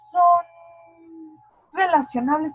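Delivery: «son» muy alto y fuerte; énfasis. Shown as a demonstration. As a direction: recalca «son relacionables» con fuerza. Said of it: "pero no son lo mismo". («son» golpeado alto, luego «relacionables»)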